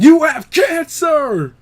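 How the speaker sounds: high, loud, slow shout; shouted insistence. Acted. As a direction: yell it, stretching each word.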